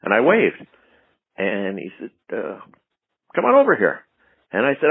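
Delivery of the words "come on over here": driver calling out. Retold or imitated. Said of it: "the driver screams out at me".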